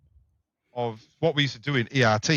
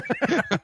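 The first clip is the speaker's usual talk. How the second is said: laugh; 13 semitones higher than usual. Real, not acted. Laughs recalling the call.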